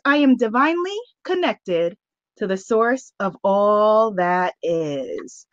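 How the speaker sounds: slow, low incantation; affirmation reading. Real, not acted. Host reads an affirmation card to her goddess sisters, slow solemn intoning.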